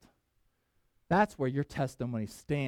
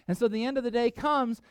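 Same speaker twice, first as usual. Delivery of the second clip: ordinary delivery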